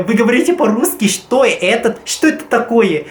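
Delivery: mock confused Russian. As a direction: play it up as baffled.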